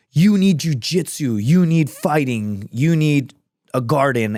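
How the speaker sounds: slow, emphatic list, each item driven home; mock insistent prescribing. Fighter telling everyone what they need, mock insistent prescribing. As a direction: pretend to prescribe it, drawing out each phrase. Acts out pushing one remedy on everyone.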